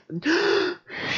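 a loud, high shocked gasp; sharp gasp. Real, not acted. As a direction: gasp in disbelief at the fall. Shocked by failed jump.

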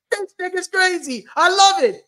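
high, loud, laughing outburst; delighted hype. Real, not acted. Co-host cheering a guest's bold numbers, thrilled. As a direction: whoop it out, stretching the last words. Reacting to retold big numbers.

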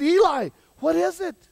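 very high, loud, exasperated-sounding question; urgent question. Acted.

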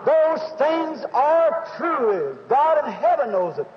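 high, drawn-out revival preacher delivery; impassioned testimony from the pulpit; fervent preaching. Acted. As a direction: proclaim it to the congregation, stretching the key words.

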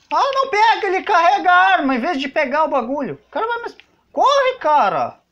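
high, loud panicked yell; being chased. Real, not acted. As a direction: shout it out as the monster closes in. Monster chasing him in game.

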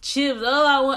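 high, loud whiny wail for food; mock whining. Retelling cravings while fasting, hammed-up whining for chicken wings. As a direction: whine like a starving kid begging for snacks. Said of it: "whining and about stuff".

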